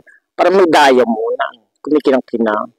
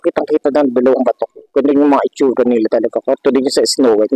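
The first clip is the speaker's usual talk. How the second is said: ordinary delivery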